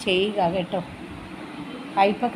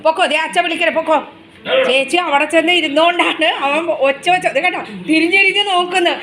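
pet-talk voice; 5 semitones higher, 4 dB louder than usual. Real, not acted. Mother sending her pet off to bed, fond scolding. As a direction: shoo the little one off, half scolding, half doting.

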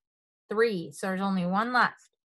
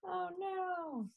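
high, hushed "oh, no"; dismayed; 7 semitones higher, 14 dB quieter than usual. A narrator's reading. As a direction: shrink into a small high voice. Reacts to Froggy's blunder.

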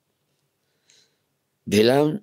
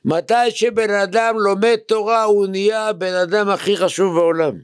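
emphatic preaching; 9 semitones higher than usual. Real, not acted. Rabbi telling students what Torah study makes you, emphatic preaching.

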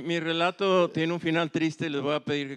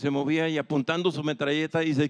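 ordinary talk, nothing marked

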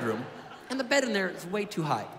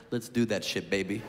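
low, laid-back stoner dad; dad's voice; 12 semitones lower than usual. Retold or imitated. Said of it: "and he goes".

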